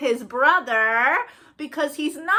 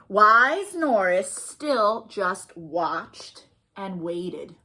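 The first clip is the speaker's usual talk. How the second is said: put-on reading to children; slower than usual. Librarian reading a picture book to kids, slow and gentle. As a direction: draw little ones into the story.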